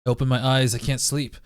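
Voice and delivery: ordinary talk, nothing marked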